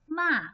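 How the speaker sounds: MA loud, falling from high; fourth tone. Shown as a demonstration. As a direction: drop MA hard from a high start. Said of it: "look at the example of tone".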